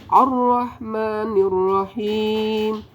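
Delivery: normal talking voice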